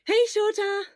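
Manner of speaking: bright, high, loud greeting; cheerful call. Acted. Hisashi calling out to Shota at the school gates, loud and bright. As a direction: call out happily across the gate.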